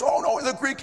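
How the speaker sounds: mock him waving the point away; dismissive professor. Professor dismissing the King James, mock dismissive. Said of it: "and he goes".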